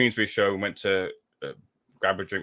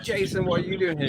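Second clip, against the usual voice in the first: excited greeting; 9 semitones higher than usual. Retold or imitated. Greeting the boxer, retold excitedly.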